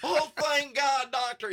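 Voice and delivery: high, slow, laughing Southern drawl; hammed-up Southern belle line; Southern accent. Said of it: "I had to do a Southern accent".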